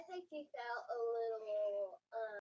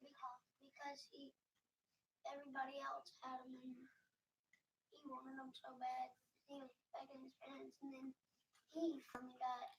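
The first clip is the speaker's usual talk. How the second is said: whispered answer; 9 dB quieter than usual. Real, not acted.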